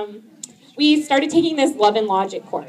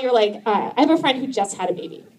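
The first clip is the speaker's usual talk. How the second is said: normal talking voice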